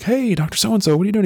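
mock-cheerful hi to a teacher; bright greeting. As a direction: sing out the greeting way up high.

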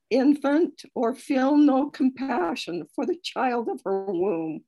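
normal talking voice

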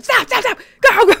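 high, loud parent yelling stop and go; half-laughing mock yell from the passenger seat; mock panicked yell. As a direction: shout it like a panicking driving teacher. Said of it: "they're yelling".

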